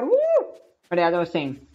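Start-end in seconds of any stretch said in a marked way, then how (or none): 0.0-0.5 gamer's celebratory woo; excited whoop. Real, not acted. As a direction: cheer it out. Cheering after a chant.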